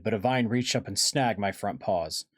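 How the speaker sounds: ordinary delivery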